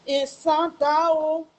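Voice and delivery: long drawn-out wail to mother; retold crying wail. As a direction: wail it out, stretching the vowels. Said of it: "I start crying".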